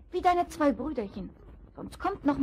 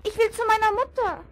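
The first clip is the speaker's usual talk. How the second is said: desperate piglet; 10 semitones higher, 4 dB louder than usual. A narrator's reading. Trapped piglet calls for mother.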